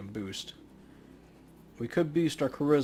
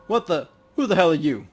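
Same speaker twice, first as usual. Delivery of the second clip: loud, raised challenge then firm introduction; hammed-up street standoff; startled tough guy; 8 dB louder than usual. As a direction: stand your ground and demand answers. Voicing characters in standoff.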